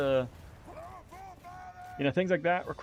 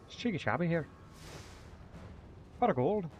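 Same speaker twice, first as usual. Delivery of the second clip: higher-pitched stage-Irish lilt; cheeky, hammed-up Irish catchphrases; Irish accent; 5 semitones higher than usual. Streamer revives his old mercenary character, put-on Irish accent. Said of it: "where I played an Irish mercenary".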